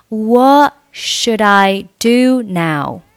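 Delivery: slowly, "What" without its t, "should I" joined; slow linked demo. Shown as a demonstration. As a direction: model "What should I do now?" slowly for learners. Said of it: "What末尾不用发音".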